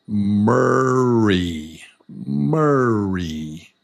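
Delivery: "Murray" stretched long and smooth over both beats; drawn-out syllables. Shown as a demonstration. Teacher trying the trick with own name, drawn-out "Murray". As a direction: linger on each syllable of "Murray". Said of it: "Ah, you hear it?".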